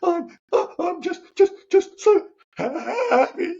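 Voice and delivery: very high, slow, halting tearful squeak; imitated happy sobbing. Crying man answers his mentor, imitated sobbing. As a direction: choke it out in a hammed-up happy cry. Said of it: "I was crying uncontrollably".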